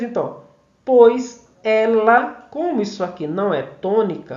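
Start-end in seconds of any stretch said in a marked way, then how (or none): 0.8-2.5 very slow, louder, syllables held apart; verse scansion. Shown as a demonstration. Teacher analysing the verse for students, loud slow scansion. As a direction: stretch each word out beat by beat.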